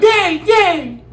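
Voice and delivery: put-on high, small child calling Dad; little daughter's high call; little girl voice. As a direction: squeak it like a little kid. Little daughter waking sleeping dad.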